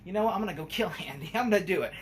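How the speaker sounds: imitating a pissed-off killer doll; angry Chucky. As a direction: fume it out like a furious doll. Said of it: "He's like".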